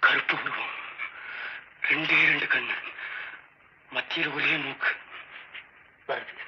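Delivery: creeping campfire horror voice; hushed suspense tale. Acted. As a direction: pull him into the dark, step by step. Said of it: "ஒரு suspense கதை, மர்மம்".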